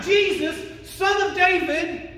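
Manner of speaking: high, loud acted call; crying out. Preacher as the beggar hailing Jesus, crying out loudly. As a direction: shout the title out, drawn out at the end. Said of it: "he's crying out".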